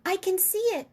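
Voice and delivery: put-on high, loud, excited little girl; excited cry. A narrator's reading. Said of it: "cried out".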